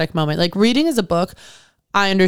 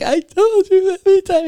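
mock-exasperated, pitched high and raised; exasperated retort; 12 semitones higher, 4 dB louder than usual. Retelling a spat with Brian, mock-exasperated. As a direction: loudly protest in a high, put-upon voice.

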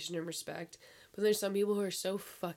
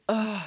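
louder, higher retold groan; mock exasperated groan; 4 semitones higher, 8 dB louder than usual. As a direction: groan like a fed-up mom. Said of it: "she goes like".

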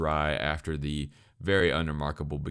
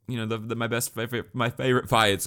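tripping over repeated words, then pushing the phrase out higher; stuttering; 6 semitones higher, 4 dB louder than usual. Real, not acted. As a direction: stumble and restart on the words, pitched up. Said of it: "I don't know why I'm stuttering so much".

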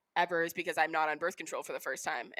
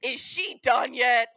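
slow, louder, sarcastic impatience; impatient mocking; 5 semitones higher, 7 dB louder, much slower than usual. Mocking society's impatience with a crying woman, slow and loud. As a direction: drag it out like an exasperated bystander.

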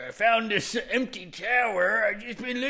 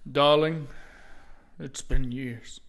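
sad king; 8 semitones lower than usual. Acted. King grieving lost daughter.

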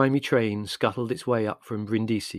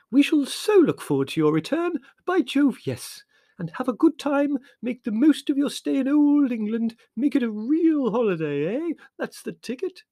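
high, loud jolly English chatter; effusive Kralevsky; 6 dB louder than usual. A narrator's reading. Kralevsky sending the family off, high effusive. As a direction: gush like a fussy old gent.

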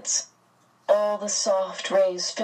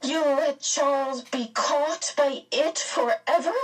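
high, shrill, appalled question; rising shrilly; 9 semitones higher than usual. A narrator's reading. Meg challenging Mrs Whatsit over Charles, shrilly. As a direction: voice climbing in disbelief. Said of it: "Meg's voice rose shrilly".

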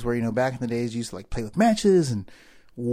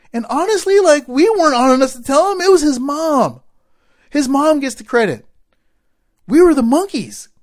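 high, loud impassioned admission; raised-voice, fervent crediting of someone else; emphatic insistence; 12 semitones higher, 12 dB louder than usual. Real, not acted. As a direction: belt out each point with conviction. Insisting mom deserves the credit.

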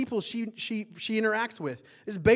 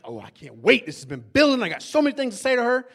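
loud, bursting to argue; pent-up impatience; 5 semitones higher, 8 dB louder than usual. Retold or imitated. Impatiently awaiting the argument.

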